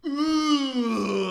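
high, loud put-on "ooh"; mock drunk wail. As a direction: wail like a wasted first-timer. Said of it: "some shit like that".

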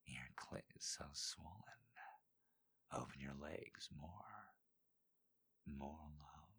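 Dean's whisper. Acted. Dean whispering to Maya in bed, hushed whisper. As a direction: whisper it close to her ear.

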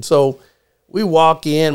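usual speaking voice

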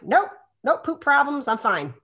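high, loud, playful dismissal; chipper denial. Retold or imitated. Telling sick doctors she is unaffected, chipper denial. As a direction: brush it off brightly, peaking at the end. Acted breezy denial of feeling ill.

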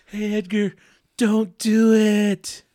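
very slow, high, loud drag; slow motion voice. Acted.

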